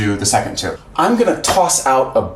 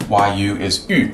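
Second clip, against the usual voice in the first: ordinary delivery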